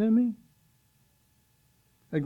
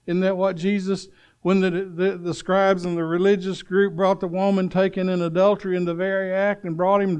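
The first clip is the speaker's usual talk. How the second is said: usual speaking voice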